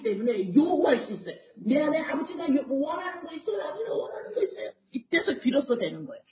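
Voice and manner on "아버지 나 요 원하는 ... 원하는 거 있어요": higher, pleading voice of a believer asking the Father; pleading prayer. Acted. Preacher voicing a believer pleading with the Father, high and soft.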